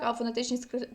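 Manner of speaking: ordinary talk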